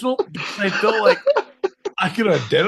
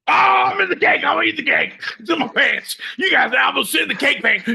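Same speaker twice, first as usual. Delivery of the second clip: mock wailing shout; 8 semitones higher, faster than usual. Wailing at the officers from the cake, hammed-up. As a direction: wail it like a toddler tantrum.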